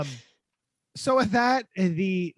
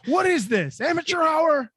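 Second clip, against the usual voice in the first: high, loud hammed-up heckle; mock outrage; 11 semitones higher, 7 dB louder than usual. Host playing a hater of the guest's videos, mock outrage. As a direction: heckle in pretend disgust. Acting out a hate comment.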